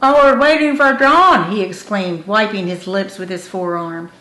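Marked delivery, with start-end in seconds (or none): 0.0-1.5 talking through a mouthful, raised; eager words round a half-eaten sandwich; full-mouthed excited reply. A narrator's reading. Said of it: "he answered Charlie with a full mouth".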